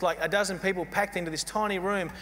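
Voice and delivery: usual speaking voice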